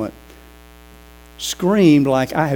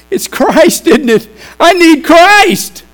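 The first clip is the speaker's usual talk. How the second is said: high, loud, slow cry of discovery; joyful realization; 12 dB louder, slower than usual. Retold or imitated. Man realizing he needs Christ, crying out high and loud in joy. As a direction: shout it out as the truth dawns. Man's sudden realization retold.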